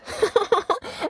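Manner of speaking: put-on very high girlish giggle; delighted high giggle. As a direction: giggle like a little girl. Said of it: "little Dot just giggled".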